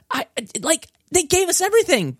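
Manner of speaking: high, breathless delight; overflowing with glee; squeaky thrilled rave; excited. Real, not acted. Raving about ports.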